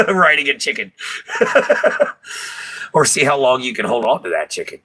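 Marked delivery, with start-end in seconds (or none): 1.0-2.9 airy squealing laugh; high breathless giggle. Real, not acted. Host cracking up at his chicken jockey joke, airy squeal.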